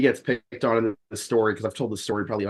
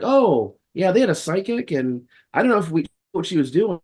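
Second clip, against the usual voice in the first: much higher, unsure and uneasy; uneasy friend; 7 semitones higher than usual. Retold or imitated. Friend describing the psychic visit, higher and uneasy. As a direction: sound unsettled by the psychic.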